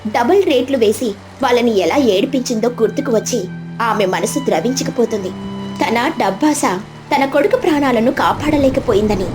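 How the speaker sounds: usual speaking voice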